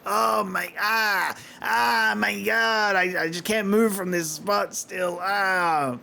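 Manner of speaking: a mock wail of a guy who can't get up; mock groaning beaten dude. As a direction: play the beaten guy stuck on the spot. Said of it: "Just like".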